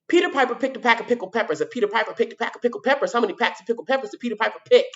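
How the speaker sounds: rattled-off tongue twister, very fast. Shown as a demonstration. Teacher showing the tongue twister at full speed. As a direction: race through it as fast as you can. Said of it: "I'm gonna do it fast this time".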